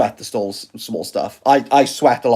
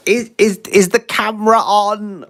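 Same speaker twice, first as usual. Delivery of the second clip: fussy camera check; 8 semitones higher than usual. Retold or imitated. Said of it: "I don't have to, like, go".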